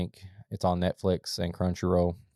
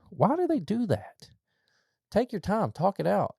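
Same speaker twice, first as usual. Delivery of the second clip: exasperated plea; 11 semitones higher than usual. Acted. Pleading rant, high pitched.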